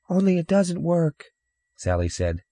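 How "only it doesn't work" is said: put-on high, small child's voice; little girl. Sister adding bad news to a stranger, little girl voice. Sally chimes in.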